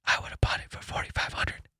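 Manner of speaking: whispered confession to the listeners; hushed confiding whisper; whispered aside. Real, not acted. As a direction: let them in on the secret in a whisper. Secret confession to audience.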